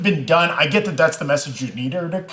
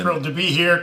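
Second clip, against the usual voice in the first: ordinary talk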